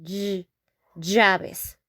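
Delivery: Ll- isolated, then llaves with a y-like start; teacher breaking down llaves. Shown as a demonstration. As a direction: sound out the ll of llaves. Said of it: "Like if it, they were a Y".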